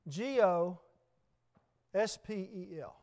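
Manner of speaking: G O S P E L spelled letter by letter. Shown as a demonstration. Preacher spells out gospel to the congregation, letter by letter. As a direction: spell out gospel one letter at a time, slowly. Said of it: "that word gospel".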